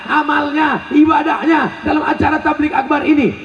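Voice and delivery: high, ringing rhetorical question; forceful preaching. Real, not acted. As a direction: call it out to a big crowd.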